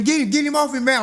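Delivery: pinned on the ground, begging friends for help; mock panicked cry. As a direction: yell for your friends to pull him off.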